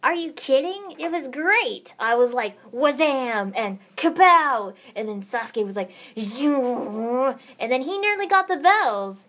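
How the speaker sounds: loud, high, gushing with sound effects; excited. Acted. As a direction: bounce off the walls with excitement.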